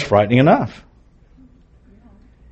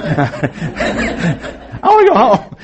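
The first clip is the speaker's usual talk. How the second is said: laughing; 11 semitones higher, much slower than usual. Real, not acted. Laughing at his own fear.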